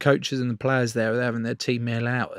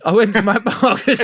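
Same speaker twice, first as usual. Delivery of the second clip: high, loud comic exasperation; loud and incredulous at the absurdity; exclaiming in disbelief; 9 semitones higher, 7 dB louder than usual. Real, not acted. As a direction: blurt it out, half laughing. Exclaims at absurd accidental wedding visit.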